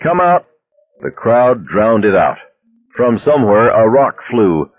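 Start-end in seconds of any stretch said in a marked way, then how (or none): 0.0-0.4 high barked order; bullhorn shout. Acted. Police on bullhorn.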